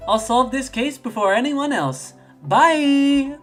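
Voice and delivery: high boast into loud farewell; eager high-voiced girl rushing off; confident birthday girl. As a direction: pitch it up, then sing out goodbye loudly. [name] dashes off boasting.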